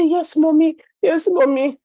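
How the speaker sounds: put-on high, small child's voice; meek little boy. Son answering his scolding mother, small and meek.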